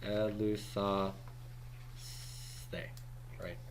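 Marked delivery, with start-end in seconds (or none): 0.0-1.1 elusaste said slowly, syllable by syllable; careful model pronunciation. Shown as a demonstration. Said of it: "second person plural, middle ending".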